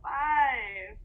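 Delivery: lively, fast storybook rhyme read aloud; reading to children. Acted. Teacher reading a counting rhyme to little ones, lively. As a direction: count the elephants out like a picture book.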